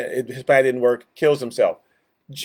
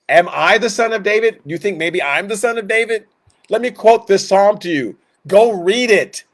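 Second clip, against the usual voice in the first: higher, louder challenge imitating Jesus in debate; preaching at Pharisees; 5 semitones higher, 4 dB louder than usual. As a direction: voice Jesus pressing home a pointed challenge. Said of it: "preaching to the Pharisees".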